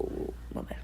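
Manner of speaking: hushed sudden whisper; quick whispered aside to himself. Real, not acted. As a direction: drop to a breathy whisper mid-stream.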